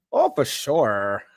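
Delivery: slow mimicry with a long "sure"; odd delivery imitated. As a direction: mimic him, dragging out "sure". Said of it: "why'd you say it like that?".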